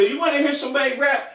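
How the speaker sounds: squeaky eager pitch to impress; mocking the hype man. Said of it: "Here we go".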